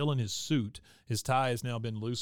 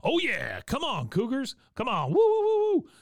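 much higher and louder, mock stadium cheering; 13 semitones higher, 7 dB louder than usual. Peggy cheering on the Cougars right after knocking Bill down, mock whooping, much higher and louder. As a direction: whoop it up like a fan in the bleachers.

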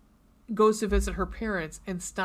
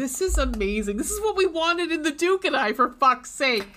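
loud, raised retold exclamation; excited outburst; 6 semitones higher, 6 dB louder than usual. Reader re-enacting joy at the book, excited outburst. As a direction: re-enact your thrilled reaction, voice climbing. Retells own delighted reaction to book.

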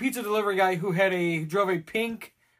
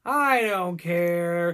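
slow, mopey I don't care; bored teen brushing off a guy, slow and mopey; Eeyore-style indifference; 2 semitones lower, much slower than usual. Retold or imitated. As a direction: drag it out like a gloomy donkey. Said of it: "She's just Eeyore".

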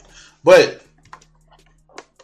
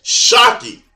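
higher, louder pretend amazement; sarcastic mock shock; 6 semitones higher, 5 dB louder, slower than usual. Creator taunting viewers who aren't the center of Marvel, sarcastic. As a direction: exaggerate the fake disbelief.

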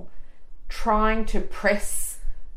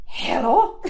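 imitating his hello; 6 semitones higher, 4 dB louder than usual. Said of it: "he just went".

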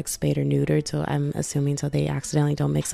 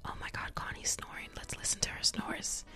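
hushed whisper so the dog stays asleep; soft unvoiced whisper beside a sleeping dog; 13 dB quieter than usual. Real, not acted. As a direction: whisper it, don't wake the dog. Dog asleep next to mic.

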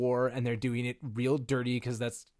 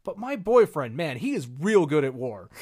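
put-on gushing girlfriend bragging, higher and louder; Padme; 3 semitones higher, 6 dB louder than usual. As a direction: brag about your boyfriend, punchy and raised. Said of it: "And Padme going".

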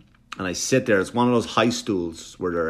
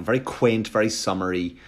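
ordinary talk, nothing marked

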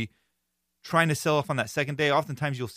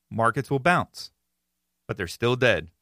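ordinary talk, nothing marked

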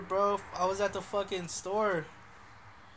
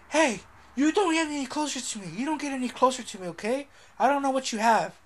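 raised, higher mock-indignant voice; imitated flipping out; 6 semitones higher, 6 dB louder, faster than usual. Retelling a lady in line flipping out at a man, mock agitated. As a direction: act out an agitated woman warning someone off. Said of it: "started flipping out".

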